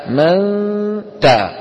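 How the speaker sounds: من تا with nasal hum, then a bare تاء; teacher's ikhfa demo; ghunna then clean تاء. Shown as a demonstration. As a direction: hold the نون nasal, cut it at تا. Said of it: "تخرج التاء مجردة عن الغنة".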